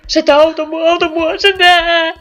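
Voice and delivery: high loud laughing cries; hysterical panic. Real, not acted. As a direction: laugh and scream in terror together.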